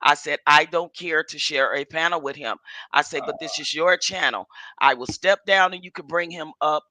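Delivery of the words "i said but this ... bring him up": normal talking voice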